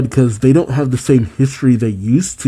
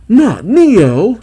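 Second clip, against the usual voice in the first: surprised outcry; 10 semitones higher, 5 dB louder than usual. Real, not acted. Reacting to a plot twist.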